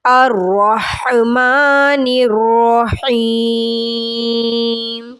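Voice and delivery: slow, drawn-out tajweed chant; measured Quranic recitation. Shown as a demonstration. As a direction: recite it melodically, stretching the vowels.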